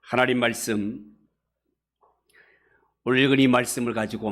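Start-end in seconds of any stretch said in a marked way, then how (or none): none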